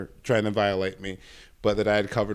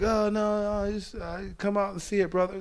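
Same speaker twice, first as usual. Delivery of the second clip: put-on old yacht guy; 10 semitones higher than usual. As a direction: play the old man who keeps insisting, brother. Imitating old guy inviting him.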